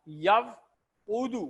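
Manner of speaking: یوعدو stretched very slowly with u vowels; slow teaching demo of یوعدو; drawn-out Arabic drill. Shown as a demonstration. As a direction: spell out یوعدو slowly, as yaw-u-du. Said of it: "دوسرا style ہے وعدہ".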